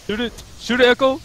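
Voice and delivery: loud, high urgent yell; excited shouting. Real, not acted. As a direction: yell at a teammate to shoot. Calling teammate to shoot.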